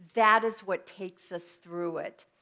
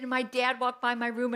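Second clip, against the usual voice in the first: ordinary talk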